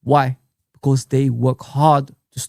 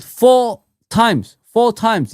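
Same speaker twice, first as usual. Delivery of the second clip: FOUR punched loud and high, slowly; angry emphasis; 7 semitones higher, 5 dB louder, much slower than usual. Real, not acted. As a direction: hammer the word four in frustration. Ranting about conceding four chances.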